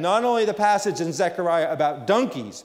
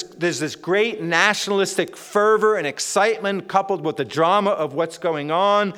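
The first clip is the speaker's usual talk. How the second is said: usual speaking voice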